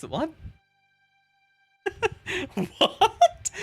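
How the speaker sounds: usual speaking voice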